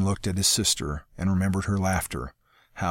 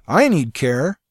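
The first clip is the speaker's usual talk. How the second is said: crying out; 8 semitones higher, 8 dB louder, slower than usual. Acted. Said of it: "Severn cried".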